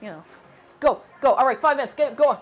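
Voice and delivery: ordinary talk, nothing marked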